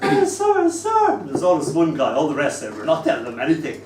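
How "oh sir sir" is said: put-on much higher, louder eager kid calling sir; child pupil. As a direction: hand up, desperate to answer. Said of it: "what were you doing before that".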